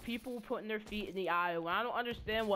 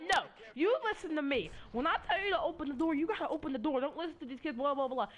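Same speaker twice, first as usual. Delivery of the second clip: scolding monitor; 6 semitones higher than usual. Retold or imitated. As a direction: scold him like a furious teacher. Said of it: "she started flipping out".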